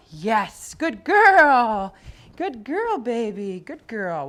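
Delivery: high, loud, slow praise as if the dog won a jackpot; gushing dog praise. Real, not acted. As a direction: reward her with your voice, stretched and bright. Praising dog after recall.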